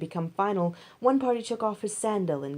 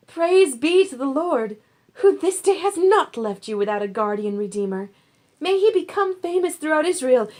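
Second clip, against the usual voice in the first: joyful praise; 8 semitones higher, 7 dB louder than usual. A narrator's reading. Women bless Naomi joyfully.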